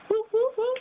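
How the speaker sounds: high whooping siren noise; imitated police siren. Drunk storyteller imitating the undercover car's lights, high mock siren.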